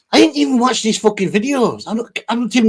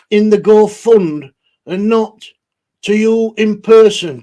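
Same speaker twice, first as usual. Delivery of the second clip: normal talking voice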